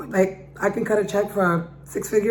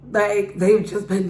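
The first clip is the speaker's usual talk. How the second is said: ordinary talk, nothing marked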